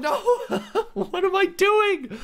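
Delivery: high, drawn-out cry with laughter; dismayed wail. Real, not acted. Player asking what am I doing, high laughing wail. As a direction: wail at your own blunder, half laughing. Falls in game, bewildered at self.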